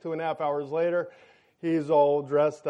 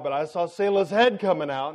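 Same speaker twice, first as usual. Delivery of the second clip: ordinary delivery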